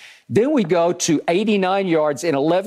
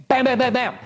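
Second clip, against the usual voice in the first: excited bam; 9 semitones higher, faster than usual. Real, not acted. Celebrating three quick scores.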